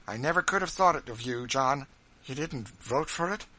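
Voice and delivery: wounded disbelief at her husband; Mrs Bird. Acted.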